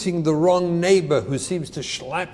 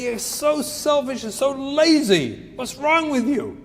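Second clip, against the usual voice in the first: higher, exasperated parental scold; mock scolding parent; 6 semitones higher than usual. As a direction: snap at the lazy kid in mock anger. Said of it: "And you go over and say".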